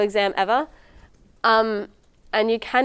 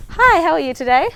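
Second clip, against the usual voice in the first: higher, brighter greeting to a patient; friendly student doctor; 7 semitones higher, 6 dB louder than usual. Acted. Medical student greets volunteer patient in role play, upbeat and higher. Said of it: "let's role play the introduction".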